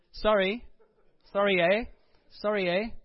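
lightly mocked Canadian, rising on each apology; Canadian caricature. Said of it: "Ca-, Canadians".

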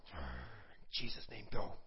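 slow, hushed, breathy whisper; whispered command. Retold or imitated. Praying at the demon behind the net, slow whisper. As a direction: whisper it slowly through the net. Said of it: "And I'm like".

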